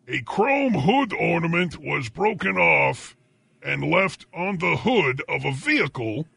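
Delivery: slow, emphatic newsreader with stretched place names; drawn-out dateline announcer delivery; news read. Acted. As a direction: announce it like a grand breaking bulletin. Reads the breaking news story.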